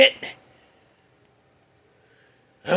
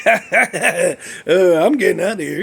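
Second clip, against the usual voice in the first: laughing; 3 dB louder than usual. Real, not acted. Laughing at himself.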